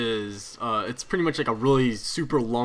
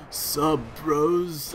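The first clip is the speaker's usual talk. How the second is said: lazy, drawn-out stoner greeting; laid-back surfer-dude hello; hippie voice; 3 semitones higher than usual. Retold or imitated. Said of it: "there's my hippie voice again".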